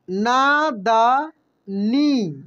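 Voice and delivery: नादानी drawn out very slowly, syllable by syllable. Shown as a demonstration. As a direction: sound out नादानी slowly for learners. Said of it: "कैसे पढ़ेंगे हम लोग?". (नादानी को धीरे धीरे अक्षर अक्षर बोलिए)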